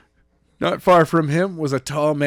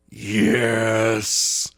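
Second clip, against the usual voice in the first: through clenched teeth. Acted. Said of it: "through clenched teeth".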